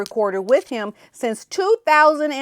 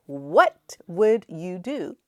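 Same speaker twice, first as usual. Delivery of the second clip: drawn-out, softer asking; slow, hushed repeat; 4 semitones lower, 6 dB quieter, much slower than usual. Shown as a demonstration. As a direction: let it hang slowly. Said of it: "can you imagine".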